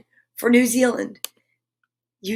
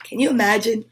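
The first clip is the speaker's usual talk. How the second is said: plain reading voice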